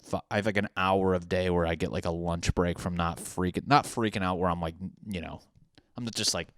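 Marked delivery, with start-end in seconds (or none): none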